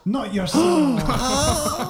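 slow dramatic cry. Acted.

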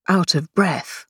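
usual speaking voice

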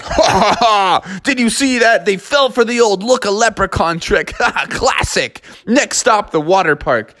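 high, cackling triumphant dad; father bear crowing to his family; gloating father bear. Acted. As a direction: laugh and brag about the trick. Father bear gloats after escape.

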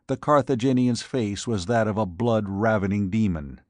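usual speaking voice

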